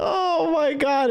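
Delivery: high laughing burst; cracking up at the story. Real, not acted. Host cracking up at the cry story, laughing.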